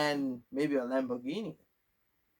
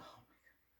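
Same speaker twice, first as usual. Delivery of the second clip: breathy embarrassed whisper under the breath; flustered whispered aside; whispered oh my God; 32 dB quieter than usual. Real, not acted. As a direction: whisper it to yourself, caught off guard. Embarrassed by sexual orientation question.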